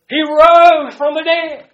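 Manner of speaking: slow, loud proclamation; preaching. Acted. Preaching climax.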